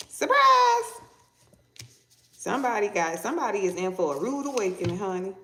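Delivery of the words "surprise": very high, louder exclamation; spring the surprise, high and loud; mock surprise shout. Mock surprise announcement in reading.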